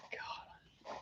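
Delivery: breathy whispered sigh of defeat. Real, not acted. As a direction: let it out on a whisper. Tired of the lost thong.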